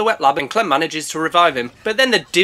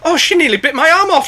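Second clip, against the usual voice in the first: high, loud panicked shrieks; panicked yelling; 11 semitones higher, 5 dB louder, much slower than usual. Pretend. Panic, shark bite.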